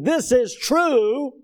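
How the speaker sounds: slow, high mocking declaration; drawn out in mock pomp; mock proclamation. Preacher mocking the claim to his congregation, slow and lofty.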